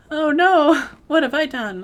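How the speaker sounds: high, loud wail of dismay; dismayed outcry. Real, not acted. Player has run out of burgers, dismayed outcry. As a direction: cry out loudly in dismay.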